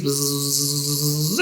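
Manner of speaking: long, steady bee-like buzz; low insect hum; bug buzz. Pretend. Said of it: "bugs are flying around our trees".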